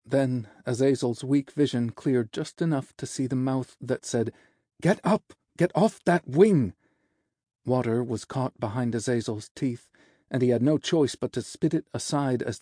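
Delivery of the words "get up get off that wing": raised, high-pitched urgent order; sharp command. Acted. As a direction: shout at the patient to move. Healer orders injured angel up.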